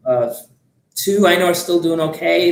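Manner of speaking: usual speaking voice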